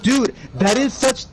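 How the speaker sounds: raging gamer. Real, not acted. Raging at Pyramid Head.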